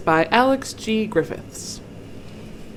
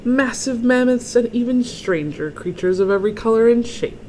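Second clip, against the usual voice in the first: story read-aloud; 3 semitones higher, 5 dB louder than usual. A narrator's reading. Reader unveils the wildlife museum to children, emphatic storytelling. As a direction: build wonder for young listeners. Reads picture book to children.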